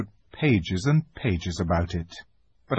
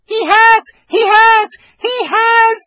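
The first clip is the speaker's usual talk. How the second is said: very high, loud squeaking shout; a panicked little squirrel blurting out; baby squirrel squealing; squeaked; 10 dB louder than usual. Acted. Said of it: "it squeaked".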